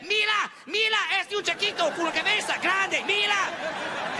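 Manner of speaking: put-on high-pitched excited child voice in Spanish; little Mexican kids. Mexican kids gawking at comedian, high chattering voice. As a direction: imitate small kids pointing something out. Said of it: "these little kids are saying".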